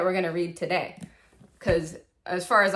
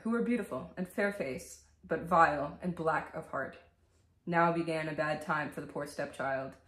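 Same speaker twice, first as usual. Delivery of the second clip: bedtime story reading; 2 semitones lower, 6 dB quieter than usual. Acted. Vlogger reading Grimm's Cinderella to viewers, soft storybook voice. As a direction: read it like a scary bedtime tale.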